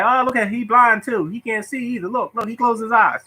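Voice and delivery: high, mocking schoolkid voice; teasing kids. Kids mocking the boy for closing his eyes, high jeering. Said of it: "And people were like".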